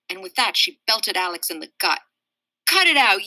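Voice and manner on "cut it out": high, loud protest; shouting. Acted. Boy shouting at the girl who punched him, loud and high. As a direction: yell at her to stop. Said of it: "Alex shouted".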